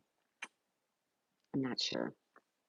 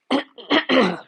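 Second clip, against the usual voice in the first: sudden loud cough; 8 semitones higher, 9 dB louder than usual. Real, not acted. Coughing mid-stream then apologizing. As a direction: cough hard, away from the mic. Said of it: "Excuse me".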